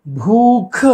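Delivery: भूख with forceful puffs on भ and ख; both sounds aspirated. Shown as a demonstration. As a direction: punch out "bhoo-kh" in भूख. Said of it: "ये सही होगा".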